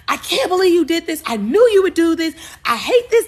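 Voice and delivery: high, loud acted-out fury; shriek-like mock outburst of hurt pride; irate outburst. Jilted bride hitting groom with flowers, irate outburst. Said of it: "[name] is so irate with the emotion of embarrassment".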